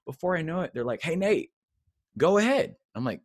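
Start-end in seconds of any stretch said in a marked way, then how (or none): 1.0-2.8 leaders calling out. Retold or imitated.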